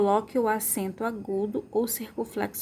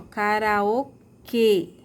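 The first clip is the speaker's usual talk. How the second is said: "karaokê" very slow, pause before a strong KÊ; "karaokê" stretched, stress on closed final ê; strongest syllable shown; 4 dB louder, much slower than usual. Shown as a demonstration. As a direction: hit the KÊ in "karaokê". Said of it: "e a sílaba mais forte".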